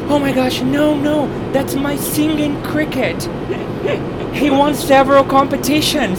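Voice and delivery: high, loud mock distress; imitated panicked scream. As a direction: plead frantically for the cricket. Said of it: "screaming at me".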